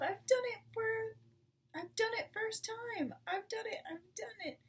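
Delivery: high, loud triumphant shouts; excited yelling. A narrator's reading. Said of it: "he yelled".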